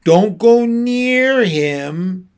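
slow it right down in warning; grave warning. A narrator's reading. Lion's warning.

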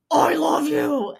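slow, loud, stern delivery; deep stern voice. Retold or imitated. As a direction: say it like a growl. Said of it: "the pitch, the tone, and the bass".

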